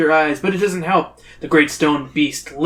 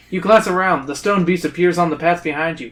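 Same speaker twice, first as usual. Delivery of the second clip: plain reading voice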